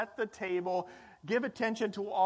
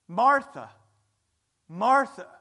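Jesus calling Martha; 4 semitones higher, 9 dB louder than usual. A narrator's reading. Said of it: "the Lord answered".

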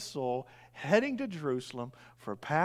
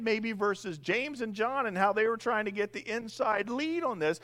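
ordinary talk, nothing marked

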